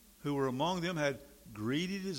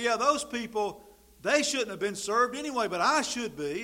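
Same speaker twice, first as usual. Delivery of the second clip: entitled whine; 5 semitones higher, 9 dB louder than usual. Retold or imitated. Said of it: "you would say".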